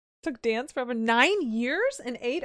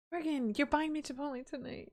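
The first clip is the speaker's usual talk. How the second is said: ordinary talk, nothing marked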